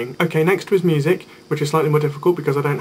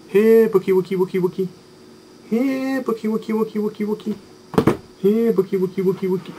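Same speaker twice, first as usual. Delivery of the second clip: higher sing-song pet call, Here, bookie, wookie; 6 semitones higher than usual. Pretend. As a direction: coax the lost book out like a puppy. Calling the lost book like pet.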